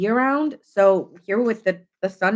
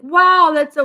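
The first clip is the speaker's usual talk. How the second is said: high, loud "wow"; expressive Gemini gushing; amazed; 8 semitones higher, 9 dB louder than usual. Retold or imitated. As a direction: burst out in delight. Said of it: "you have a lot of expression".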